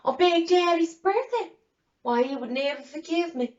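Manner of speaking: imitating a shocked sister-in-law, high voice; gasp, then protest high and loud; scandalized gasp. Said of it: "looked at me with big eyes and".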